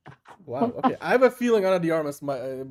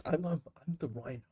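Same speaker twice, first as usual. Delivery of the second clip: Robert De Niro impression; 2 semitones higher than usual. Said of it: "Robert De Niro".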